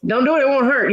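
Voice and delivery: high-pitched mock-helpful singsong; teasing catchphrase. Calling from her room after his crash, teasing singsong.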